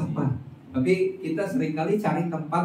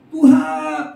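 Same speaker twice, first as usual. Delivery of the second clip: much higher and louder, pleading shout; crying out to God; 4 dB louder than usual. Acted. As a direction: call on God with all your heart. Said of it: "Tapi waktu kita teriak".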